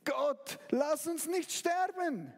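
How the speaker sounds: slow, rising plea in fear; desperate prayer. Acted. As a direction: beg God not to let you die. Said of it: "Und du fängst an zu denken, Gott". (verzweifeltes, langsames Flehen)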